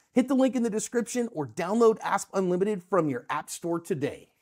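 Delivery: upbeat promo read, lively pitch. Acted. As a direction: sell the app like a commercial. Reads app promo.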